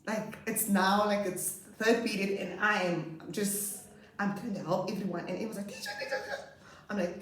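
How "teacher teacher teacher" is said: put-on very high squeaky child voice; squeaky, insistent mimicry; nagging pupil. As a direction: pester the teacher like a little kid. Imitating nagging boy.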